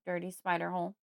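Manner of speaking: ordinary delivery, nothing marked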